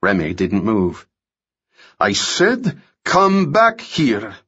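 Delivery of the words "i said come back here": villain repeating his command, angrier; repeated order. Acted. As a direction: press the order harder.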